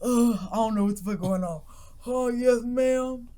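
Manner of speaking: lightly acted groggy innocence; feigned sleepiness. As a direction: play dumb as if just woken. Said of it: "pretending to sleep".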